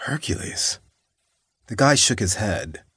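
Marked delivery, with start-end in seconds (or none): 0.1-0.8 "Hercules" whispered, high and puzzled; confused whisper. A narrator's reading. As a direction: breathe "Hercules" like a dazed echo. Dazed man echoes the name.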